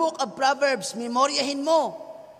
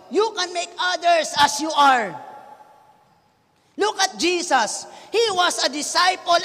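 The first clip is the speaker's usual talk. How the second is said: emphatic preaching; 4 semitones higher, 5 dB louder than usual. Acted. Preacher driving home the point.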